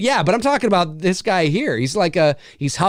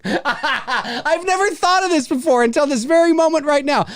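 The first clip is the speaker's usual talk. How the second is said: laughing; 9 semitones higher than usual. Real, not acted. Laughing mid-rant.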